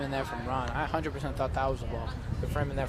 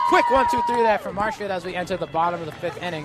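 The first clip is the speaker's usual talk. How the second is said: excited play-by-play; 6 semitones higher, 8 dB louder than usual. Real, not acted. Exciting double play call.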